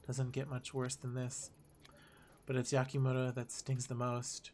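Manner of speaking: low-key, subdued story reading; muted, reflective game narration; detective's inner monologue. Acted. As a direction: brood quietly over the case.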